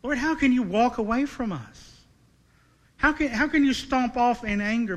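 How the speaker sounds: acted psalmist's appeal; emotional plea. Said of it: "That's the emotion of the passage".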